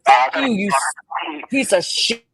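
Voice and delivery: slowed, shouted curse; furious outburst at the chat; angry shout. Real, not acted. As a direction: yell the insult at her. Cursing at Southern Girl.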